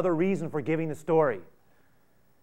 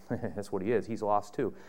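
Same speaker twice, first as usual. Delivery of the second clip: ordinary delivery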